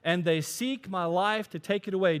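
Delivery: loud, high, aggrieved prophet; complaining Elijah. Acted. Preacher voicing Elijah complaining to God, loud and high. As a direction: pour out your complaint to God loudly. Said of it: "He said".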